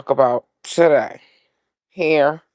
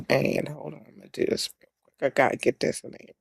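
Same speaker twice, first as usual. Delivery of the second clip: muttering; 8 dB quieter, faster than usual. Real, not acted. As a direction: mutter to yourself. Muttered aside while fixing.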